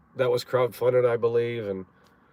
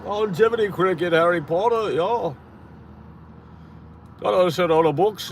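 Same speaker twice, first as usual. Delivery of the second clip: higher, hammed-up British accent; mock British accent; 6 semitones higher, 5 dB louder than usual. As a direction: put on a silly British "hello, mate" voice.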